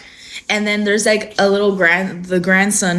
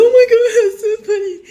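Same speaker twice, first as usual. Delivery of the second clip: high, fast, gushing laughter; hammed-up imitation of an easily amused mom; mocking mom's amusement; 13 semitones higher, 4 dB louder, much faster than usual. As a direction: squeal it through exaggerated giggles. Mocks mom's reaction to videos.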